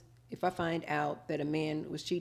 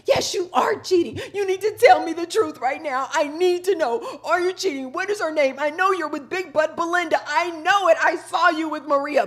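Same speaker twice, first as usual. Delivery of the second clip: high, much louder angry confrontation; yelling accusations; 11 semitones higher, 9 dB louder than usual. Retold or imitated. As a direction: scream at the cheating man, demanding the truth. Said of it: "because I'm not just like".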